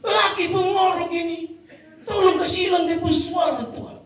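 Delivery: high-pitched pleading wife praying aloud; épouse en prière. Acted. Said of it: "seorang ibu yang berdoa".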